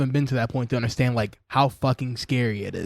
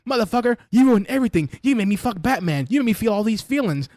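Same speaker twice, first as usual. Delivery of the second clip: mock furious Batgirl; 10 semitones higher than usual. Batgirl screaming at the villain, high hammed-up fury. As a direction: rage at him, hammed-up. Voicing Batgirl yelling at him.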